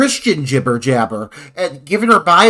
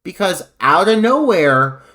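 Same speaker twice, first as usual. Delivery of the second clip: usual speaking voice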